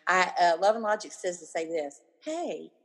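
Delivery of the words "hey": innocently curious, soft "hey"; puzzled questioning. Shown as a demonstration. Parent answering a teen's eye roll, puzzled questioning. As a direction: open with a quizzical hey. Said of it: "confused look on your face".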